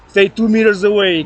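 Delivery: ordinary talk, nothing marked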